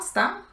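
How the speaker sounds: sta said high and punched; a sharp high accent on sta; stressed first syllable. Shown as a demonstration. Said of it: "El acento va en la primera sílaba".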